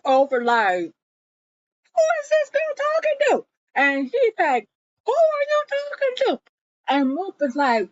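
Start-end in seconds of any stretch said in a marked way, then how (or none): 1.9-3.4 high, fast mocking voice; mock outraged man. Jonah's retold indignant question. 5.0-6.4 high, fast mocking voice; mock outraged man. Jonah's retold indignant question.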